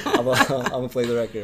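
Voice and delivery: loud, laughing through the quote; laughing retelling. Retold or imitated. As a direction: crack up while acting out your own reply. Retelling his flustered reply, amused.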